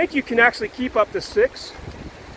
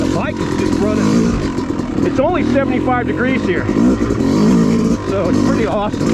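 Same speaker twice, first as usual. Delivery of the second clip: over engine noise; 4 dB louder than usual. Real, not acted. Talking while riding the dirt bike.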